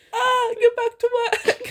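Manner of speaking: high, slow, loud through giggles; laughing mock order. Real, not acted. Telling co-reader to keep reading, laughing mock order. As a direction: boss them back to reading while laughing.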